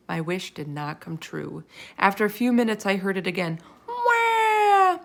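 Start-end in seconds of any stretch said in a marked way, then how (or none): 3.9-5.0 put-on high, loud baby wail; baby crying. A narrator's reading. Narrator imitating the baby crying out. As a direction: let out a newborn wail.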